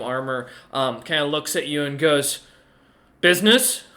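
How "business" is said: lightly voiced troll, louder and higher; a curt troll's question, put a little louder. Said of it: "large, uh, troll kinda stands there".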